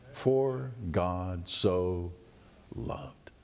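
drawn out, fading to a whisper; very slow. Shown as a demonstration. Preacher slowing the verse to make the point, fading out. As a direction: savour each word. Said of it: "Watch this".

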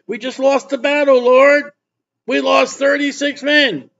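high-pitched protest to the Lord; acted, high-pitched plea; pleading. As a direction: plead upward as a defeated leader. Pastor's plea to God acted out.

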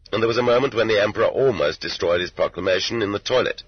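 ordinary delivery

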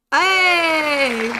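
loud, drawn-out cheer; excited shout. Real, not acted. Player spotting Dots proof, excited shout. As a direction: shout out at a sudden find.

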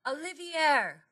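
high, drawn call after a pet. Acted. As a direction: call the cat's name across the street. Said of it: "Mister Sims called out".

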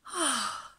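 long relieved sigh; boy sighing as worry lifts; long sigh of relief. Acted. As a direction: let the breath go in relief. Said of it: "a long sigh of relief".

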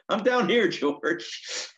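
high, loud mock holler; calling out. Opener answers Carlin upstairs from dressing room, calling out. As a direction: shout back up the stairs.

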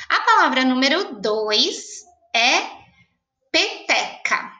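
slow, held dictation of peteca. Shown as a demonstration. Teacher dictating peteca slowly to pupils. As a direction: draw the word out for writing.

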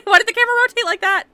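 high, loud laughing alarm; startled shriek. Real, not acted. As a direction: shriek the question in shocked protest. Startled by the game camera.

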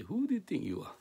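high-pitched mock challenge; indignant opponent, imitated in a high voice; acted challenge. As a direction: fling the question at him, pitched right up. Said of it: "They challenge. They're against Jesus".

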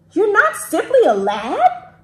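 loud, high questioning exclamation; mild storybook shout. A narrator's reading. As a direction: raise it high as the shouting dragon. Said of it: "shouted Dram".

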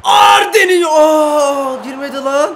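very high loud yell as he shoots; high shout then a cry of dismay; shouted own name. Real, not acted. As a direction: scream his name as the ball flies.

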